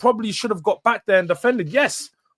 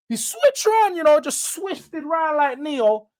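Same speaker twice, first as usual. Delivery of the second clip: excited; 8 semitones higher than usual. Real, not acted. Excited Matrix comparison.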